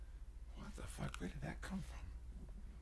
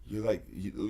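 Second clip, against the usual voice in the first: normal talking voice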